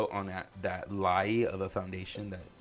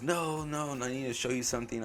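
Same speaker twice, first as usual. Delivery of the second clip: higher-pitched, insistent imitation; retold insistence; 5 semitones higher than usual. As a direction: imitate him brushing off objections. Retold insistent driver refusing.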